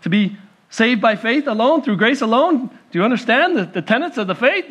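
usual speaking voice

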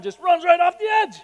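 high, loud, breathless storytelling; preacher reliving a terrifying sixty-foot jump, high and loud; excited retelling. Real, not acted. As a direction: tell it like you're reliving the jump.

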